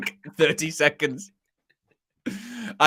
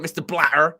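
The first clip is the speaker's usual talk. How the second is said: lightly Bristolian Mister Blatter; a hint of a Bristolian accent; Bristolian Ian Holloway. Pundit quoting Holloway, light Bristolian accent.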